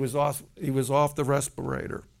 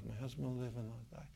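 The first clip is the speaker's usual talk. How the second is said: barely audible murmur, much softer; real low; 5 semitones lower, 18 dB quieter, faster than usual. Retold or imitated. Wife timidly murmuring the healing confession, very soft. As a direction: mumble it under your breath, unsure. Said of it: "like, real low".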